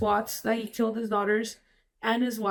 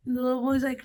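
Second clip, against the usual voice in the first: normal talking voice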